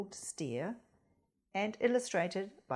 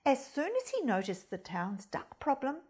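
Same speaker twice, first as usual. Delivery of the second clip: usual speaking voice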